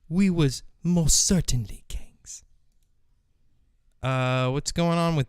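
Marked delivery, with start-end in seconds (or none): 0.1-2.4 breathy hushed whisper of former glory. Acted. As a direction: whisper it like a secret. Whispered echo of the lament.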